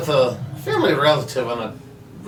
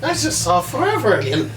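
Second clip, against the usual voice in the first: dazed quote; 7 semitones higher than usual. Acted. Player dazed after brain scan.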